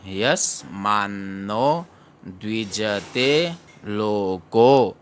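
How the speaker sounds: slow syllabic verse reading, long final vowels; slow model reading. Shown as a demonstration. Teacher re-reading the first quarter of the verse, slow and measured. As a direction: recite यस्मान्नो द्विजते लोको slowly, word by word. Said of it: "उसके बाद में पढ़ेंगे".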